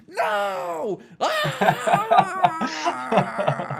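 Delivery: high-pitched drawn-out scream of NO; imitated wail, melting down; meltdown yell. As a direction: shriek it like a hammed-up tantrum. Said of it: "yelling in all caps".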